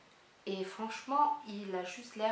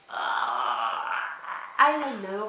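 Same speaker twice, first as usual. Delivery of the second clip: triumphant cry; 7 dB louder, much slower than usual. Real, not acted. Guess proven right on unwrapping.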